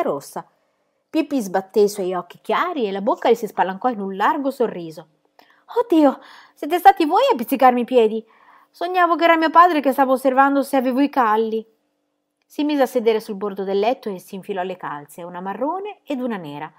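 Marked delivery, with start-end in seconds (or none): 5.8-11.7 put-on high, loud playful girl; cheeky girl voice. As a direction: voice Pippi high and bright with mischief. Pippi voiced by narrator.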